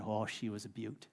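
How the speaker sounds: low, hushed mock Australian accent; Crocodile Hunter. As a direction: murmur it in a lightly imitated Australian accent. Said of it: "in that cool accent of his".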